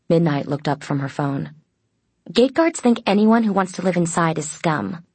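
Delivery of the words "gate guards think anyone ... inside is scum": mildly acid, raised pitch. A narrator's reading. Midnight sneering at gate guards' contempt, acid. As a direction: say it with a touch of scorn. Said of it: "Her tone was acid".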